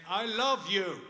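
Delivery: full high shout to be heard; shouted repeat. Retold or imitated. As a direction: shout it to a hard-of-hearing father. Hard of hearing.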